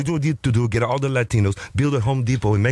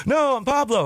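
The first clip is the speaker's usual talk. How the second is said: exasperated reply; 12 semitones higher than usual. Retold or imitated. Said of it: "I go".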